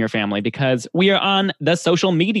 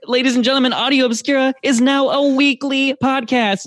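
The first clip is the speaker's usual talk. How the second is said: infomercial announcer; 12 semitones higher, 4 dB louder than usual. Acted. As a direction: boom it out like a TV pitchman. Grand announcement to listeners.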